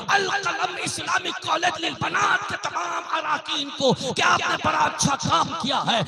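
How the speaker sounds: high, fervent praise from the pulpit; impassioned preaching. Real, not acted. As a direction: proclaim it to the whole gathering.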